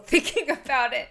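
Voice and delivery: choking up with a small laugh; real emotion creeping in, laughed through; getting emotional. Real, not acted. Daughter thinking of parents' sacrifice, getting emotional. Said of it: "getting emotional".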